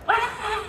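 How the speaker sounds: sudden high "Wah!" yelp; playful dog yelp; mock dog outburst. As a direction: imitate a dog lunging with a yelp. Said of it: "the dog all of a sudden goes".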